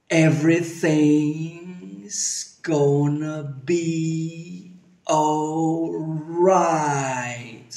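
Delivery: stretched-out, very slow speech; slow motion. Shown as a demonstration. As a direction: drag every syllable out. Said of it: "vamos a decir la frase en cámara lenta".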